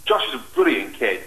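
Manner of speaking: ordinary talk, nothing marked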